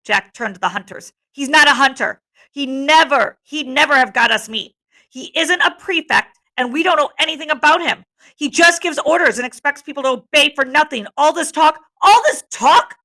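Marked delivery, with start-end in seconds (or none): 1.3-11.1 higher-pitched, insistent tirade; heated denunciation. A narrator's reading. Jack turning to the hunters against Ralph, heated. 11.1-13.0 high, loud outburst; exasperated shout. A narrator's reading. Ralph fed up at the meeting, shouting. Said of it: "shouted Ralph".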